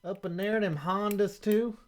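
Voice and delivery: hammed-up good ol' boy scoffing at Europeans. Mocking NASCAR fans' view.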